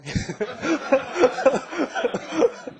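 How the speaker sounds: high, rolling burst of laughter; amused laugh. Real, not acted. Storyteller laughing after wondering why they never went back, amused laugh. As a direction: laugh out loud at your own wry remark. Wry joke about never returning.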